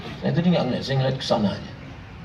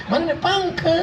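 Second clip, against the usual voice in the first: mock coquettish; 9 semitones higher, 3 dB quieter than usual. Shown as a demonstration. Showing mothers how not to answer the phone, mock flirtatious. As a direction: coo it with exaggerated sweetness. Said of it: "Gitu, jangan".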